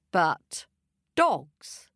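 plain reading voice

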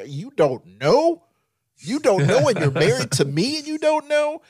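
loud, high, rising mock outrage; incredulous outburst. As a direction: build to a squeal of disbelief. Acted incredulous retort to wife.

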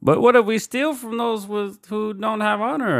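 high, slow put-on character voice; butchered movie line. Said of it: "I think I just butchered that".

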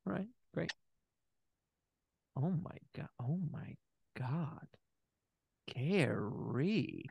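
stunned hush. Acted. Doctor overwhelmed by Gary's smile.

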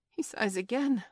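lightly voiced worried sailor; concerned pirate. A narrator's reading. Pirate remarks on captain's sigh.

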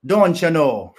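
drawn-out, loud mock Canadian; mock Canadian accent. Showing how they talk in Canada, in a mock Canadian accent. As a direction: put on a joke Canadian accent, stretched out. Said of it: "That's how they talk in Canada".

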